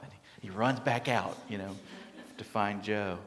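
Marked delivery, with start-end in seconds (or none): none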